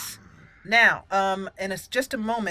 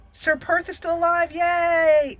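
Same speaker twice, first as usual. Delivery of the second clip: high, loud little cheer; excited cheer; 9 semitones higher, 6 dB louder, slower than usual. Real, not acted. Gamer celebrating that the party survived, cheering. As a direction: cheer that everyone lived.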